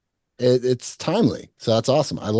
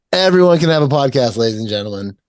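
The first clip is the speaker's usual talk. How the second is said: louder mock proclamation to an audience; mock announcer; 4 dB louder than usual. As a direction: announce it grandly to the crowd.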